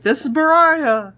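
high exaggerated self-introduction; acting out herself. Recalling her own montage line, high self-mimicry. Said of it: "I'm like".

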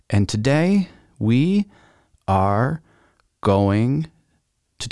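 each word spaced out with long pauses; slow dramatic. Acted. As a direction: stretch it out like a big reveal. Drawn-out dramatic intro.

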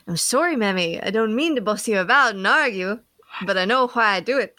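put-on lilting amateur Irish child; attempted Irish accent. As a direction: have a go at a small Irish girl's accent. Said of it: "gonna try and do the accent".

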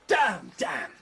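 shouted curse in the dark; sharp frustrated shout; angry outburst. Acted. Chair breaks in the dark.

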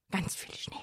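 soft whispered wish; whispering. Acted. Wishing for snow.

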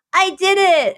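high, chirpy self-praise; mock proud self. She mimics praising herself for a graphic, high and chirpy. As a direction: cheer yourself on like a kid. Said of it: "I'm like".

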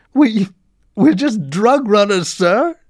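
high, loud tearful plea; acted sobbing, halting at the start; sobbed confession. A narrator's reading. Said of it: "he sobbed".

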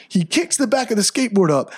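usual speaking voice

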